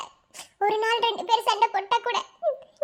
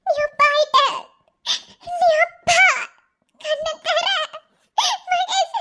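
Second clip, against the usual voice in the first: higher, tearful joyful telling; emotional joy; 6 semitones higher than usual. Acted. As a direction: tell him through happy tears. Wife tearfully repeats news. (மகிழ்ச்சி கண்ணீருடன்)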